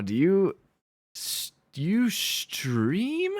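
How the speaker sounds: high, slow, drawn-out disbelief; astonished question. Real, not acted. Astonished discovery that viewer streams.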